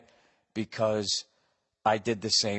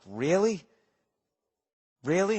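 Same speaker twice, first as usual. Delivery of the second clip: incredulous; 6 semitones higher than usual. Retold or imitated. Retells doubting the man's age claim.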